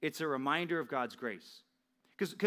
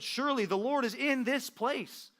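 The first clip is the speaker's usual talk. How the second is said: much higher, slow and wondering; awestruck realization; 10 semitones higher than usual. Acted. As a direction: Jacob stunned at waking. Jacob wakes in awe.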